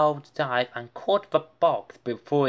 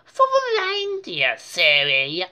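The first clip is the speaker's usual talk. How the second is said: put-on high, louder scolding kid; know-it-all; 5 semitones higher, 5 dB louder than usual. A narrator's reading. D W teasing Arthur about the reindeer. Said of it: "said D W".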